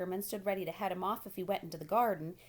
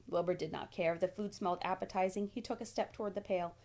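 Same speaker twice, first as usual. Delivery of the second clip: usual speaking voice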